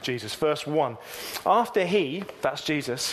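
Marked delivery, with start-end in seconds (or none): 1.5-2.2 measured Bible reading voice; scripture reading. Acted. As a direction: read the verse out to the congregation. Reads scripture passage aloud.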